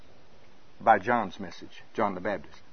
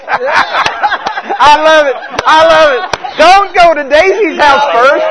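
much higher squealing laughter through the words; laughing delight; 14 dB louder, slower than usual. Retold or imitated. Recipient of a million dollars, laughing and squealing with glee.

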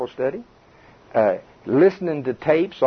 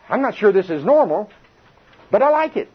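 pleased surprise; 7 semitones higher than usual. Pretend. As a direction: voice climbs much higher toward I like it. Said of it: "you are".

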